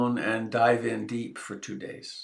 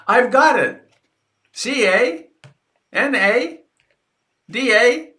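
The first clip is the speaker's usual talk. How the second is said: much higher, much louder triumphant announcement; John A. Macdonald's eureka; 13 semitones higher, 10 dB louder, slower than usual. Retold or imitated. Founder announcing the country's name, spelled out proudly. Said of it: "and he said".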